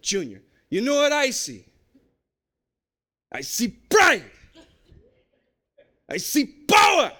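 building to a shout, in a bad Jamaican accent; slow and swelling, a shouted Jamaican pep talk. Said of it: "No, it's terrible".

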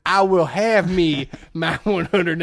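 slow, loud, hammed-up Southern accent; Aldo Rains. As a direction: stretch it out in a bad Southern drawl. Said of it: "I came up with this accent".

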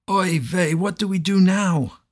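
high, loud anguished wail. A narrator's reading. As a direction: wail it out in despair. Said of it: "the innkeeper wailed".

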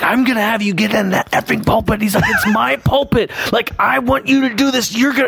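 a half-hearted mock yell, high and tight; imitating a screaming pastor. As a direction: mimic the boss losing his temper, voice up high. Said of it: "he begins screaming at me".

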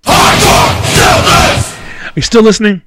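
sudden loud shout at the listeners; calling out. Real, not acted. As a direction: shout to wake up the audience. Shouting out to check on the audience.

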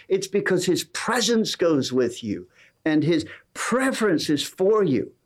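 lifted, urgent preaching voice, slowing on key lines. Acted. Pastor rousing his congregation, fervent preaching. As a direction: build it like a pulpit climax.

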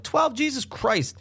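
high-pitched incredulous outburst; shocked disbelief. Retold or imitated. Son hears dad's laxative count, shocked disbelief. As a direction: squeak it in retold shock. Son reacts to father's confession.

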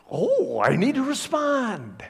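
high, drawn-out Southern drawl; put-on Southern preacher drawl; Billy Graham. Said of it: "Billy Graham has this kind of Southern drawl".

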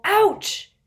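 high, loud cry of pain; sharp, high "ouch"; pained yelp. A narrator's reading. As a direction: yelp as if suddenly grabbed. Pinned against the wall.

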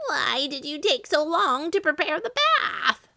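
high, angry witch's croak; croaked, furious accusation; Baba Yaga. A narrator's reading. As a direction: scold in a high, strained witch voice. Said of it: "she croaked".

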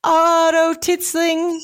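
high, held, intoned name; grandly intoned, slow and high; drawn-out mock announcement. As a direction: stretch the name out slowly on one high note. Mock announcing the man seen.

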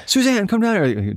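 imitating Dennis calling out. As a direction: call her down in a high, excited imitation. Dennis calling his wife.

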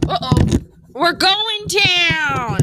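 drawn-out, loud, high alarm call; dramatic cry. Pretend. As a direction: wail it like a sinking ship. Mic failing, dramatic announcement.

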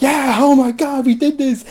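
high, gushing mock cheer; giddy triumph. Heroes grinning at each other after the rescue, giddy high cheer. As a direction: squeal it like you just pulled off the impossible.

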